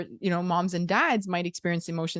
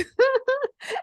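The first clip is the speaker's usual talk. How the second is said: high disbelieving laugh; 4 dB louder than usual. Real, not acted. Laughing at child's remark.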